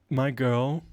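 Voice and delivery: usual speaking voice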